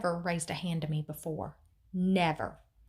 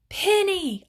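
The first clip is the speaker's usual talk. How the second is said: high, loud alarmed call; yelling; 11 semitones higher, 8 dB louder than usual. A narrator's reading. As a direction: yell the name in alarm. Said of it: "I heard him yell".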